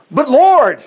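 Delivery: loud, high mock protest; exclaiming. [name] objects to the Lord's order, exclaiming loudly. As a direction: burst out in acted objection to the Lord. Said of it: "exclaimed [name]".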